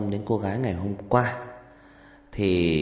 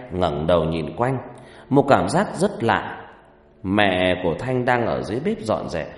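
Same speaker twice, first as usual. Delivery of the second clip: story narration; 4 dB louder than usual. A narrator's reading.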